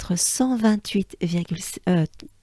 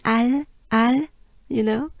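"Al" with a French open A and light L; higher, isolated "Al" in a French accent; 4 semitones higher than usual. Shown as a demonstration. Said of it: "with a French accent".

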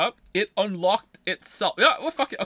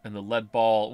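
story narration; 8 semitones lower, 5 dB quieter than usual. Acted. Reads game narration aloud.

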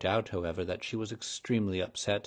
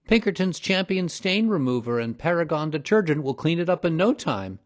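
loud boast; 8 semitones higher, 8 dB louder than usual. A narrator's reading. Boy boasts of stain remover.

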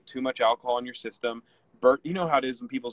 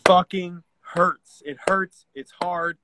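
grieving; 6 semitones higher than usual. Real, not acted. Grieving a friend who just died.

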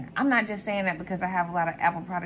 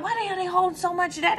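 higher, fast astonished question; baffled; 9 semitones higher, faster than usual. Retold or imitated. Viewer reacting to the ledger, fast high disbelief. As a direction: rattle it off in amazement. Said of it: "you be like".

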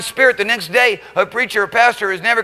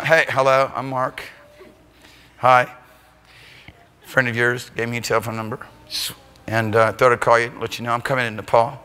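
unexcited; 10 semitones lower, 4 dB quieter than usual. Shown as a demonstration. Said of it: "it wasn't that excited".

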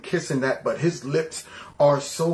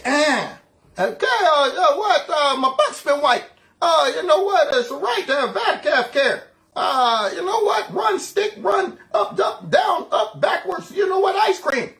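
high, raised mock-confused babble; muddled Joe impression; 11 semitones higher, 3 dB louder than usual. Host mocking how Joe talks, high muddled babble. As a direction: ramble random words as a befuddled Joe. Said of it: "That's how he talks".

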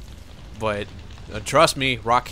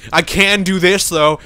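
loud, high cocky claim; loud mock boast; 7 semitones higher, 11 dB louder, slower than usual. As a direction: blurt it like an overconfident character. Quoting the game character.

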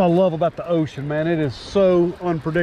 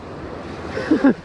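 breathless giggle; 7 semitones higher, 3 dB quieter than usual. Real, not acted. Giddy after the catch.

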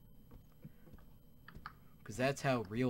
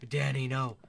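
mock anguish; 3 semitones higher, 10 dB louder, slower than usual. Companion dies, mock grief.

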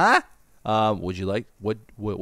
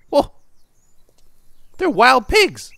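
higher, louder surprised burst; 11 semitones higher, 6 dB louder, slower than usual. Real, not acted. Gamer spotting wild pigs on the road, surprised shout. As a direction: blurt it out in disbelief.

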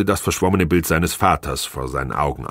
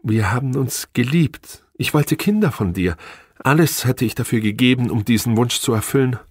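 higher-pitched voice of a wronged wife; pleading wife; 6 semitones higher than usual. A narrator's reading. Wife telling husband she wanted his children, high and pleading. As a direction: plead your lost love in a high voice.

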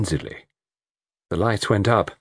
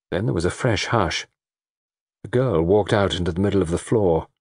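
usual speaking voice

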